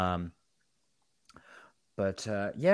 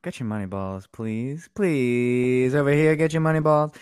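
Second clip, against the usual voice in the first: loud hawker calling out to the crowd; stadium vendor call; 5 semitones higher, 5 dB louder, slower than usual. Pretend. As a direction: shout it like a ballpark seller.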